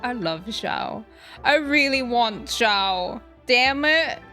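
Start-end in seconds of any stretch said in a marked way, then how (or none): none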